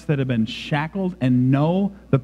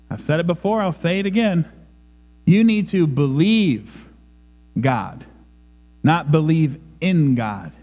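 normal talking voice

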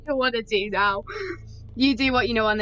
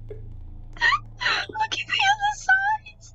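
much higher and slow squeak; silly squeaky repeat; much slower than usual. Pretend. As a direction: stretch it out in a tiny voice.

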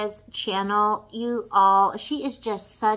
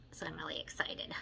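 fast, softer excited whisper; 4 semitones lower, 15 dB quieter, faster than usual. Real, not acted. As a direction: hush it like a secret thrill. Excited about purple shade.

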